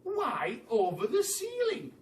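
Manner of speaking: high, baffled question; puzzled cry. Acted. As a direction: demand to know why. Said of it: "they all cried".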